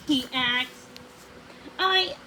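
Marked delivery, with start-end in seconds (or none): 1.8-2.2 high, halting false start; stammering. A narrator's reading. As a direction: stumble over the first word, caught out. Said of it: "Odette stammered".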